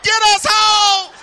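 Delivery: high, loud, drawn-out scared wail; stretched panicky shout to go home; kids pleading in panic. Retold or imitated. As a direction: plead like a terrified little kid. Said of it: "We're like".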